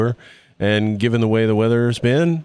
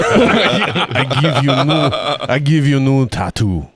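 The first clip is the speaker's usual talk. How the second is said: put-on Russian accent; 4 semitones higher than usual. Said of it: "tattoos in a Russian accent".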